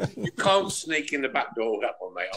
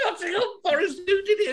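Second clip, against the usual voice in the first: much higher, squeaky words through tears of laughter; helpless laughter. Real, not acted. Host cracks up at a friend's pun, laughing through his words. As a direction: crack up while asking.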